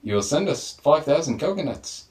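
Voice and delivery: hammed-up Irish warlord voice; Irish turtle warlord. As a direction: deliver a comic ransom demand in character. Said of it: "give us an Irish turtle warlord".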